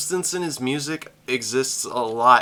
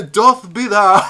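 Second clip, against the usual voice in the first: hammed-up olde-English poet, louder and laughing; mock Renaissance poet; 6 semitones higher, 10 dB louder than usual. Reactor pictures a Renaissance poet answering critics, mock lofty. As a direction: ham up a lofty bard through laughter. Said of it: "I just imagine a Renaissance poet".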